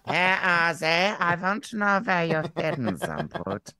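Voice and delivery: high, slow, unhinged psyker voice; unhinged menace. Acted. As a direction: drawl it out like a creepy loner. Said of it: "I'm going into the loner psycho voice now".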